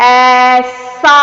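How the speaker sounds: ऐसा drawn out slowly, teacher model; teacher models ऐसा slowly for the class; careful aisa. Shown as a demonstration. Teacher drilling a child on ऐसा, slow and loud. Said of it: "फिर से बोलो".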